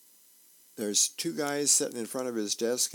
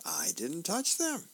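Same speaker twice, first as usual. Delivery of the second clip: higher-pitched mock protest, rising at the end; protesting denial; 7 semitones higher than usual. Wife answers husband's accusation about socks, protesting. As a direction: deny it in a raised comic voice. Acted denial in comic quarrel.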